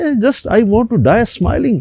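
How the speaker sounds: high, slow, chuckling mock laugh; imitating laughter. Instructor laughing as the plane goes down, slow and high. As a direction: let the words roll out through a retold laugh. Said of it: "laughing".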